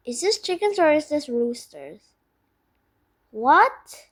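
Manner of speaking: ordinary delivery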